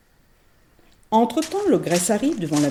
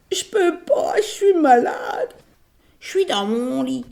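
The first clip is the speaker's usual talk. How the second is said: higher whiny fake-sick voice; mock sick granny; 7 semitones higher, slower than usual. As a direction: pretend to be ill. L'ogresse fait la malade. (fausse mémé malade)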